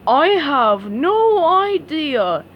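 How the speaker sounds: very slow, dopey drawl; slowly. A narrator's reading. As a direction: stretch every word out blankly. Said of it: "he said slowly".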